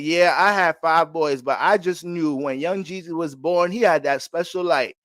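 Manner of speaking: drawn-out Southern grandma pride; Georgia old lady. Retold or imitated. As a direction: play a proud old Georgia mother reminiscing. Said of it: "that's my Georgia old lady".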